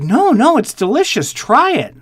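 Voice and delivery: put-on high, bright kid voice insisting it's delicious; hammed-up little girl urging; mock little girl. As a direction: play the pushy kid coaxing. Speaker voices the girl pleading.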